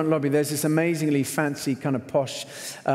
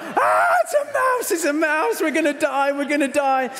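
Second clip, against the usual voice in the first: high, louder shrieking panic; screaming panic; 11 semitones higher, 4 dB louder than usual. Retold or imitated. Husband seeing the mouse, shrieking in mock terror. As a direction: scream it like the mouse will kill you. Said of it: "I just begin to scream".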